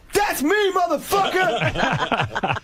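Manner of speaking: mock shouted brag. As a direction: shout it out the car window, laughing. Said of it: "leans his torso out and he goes".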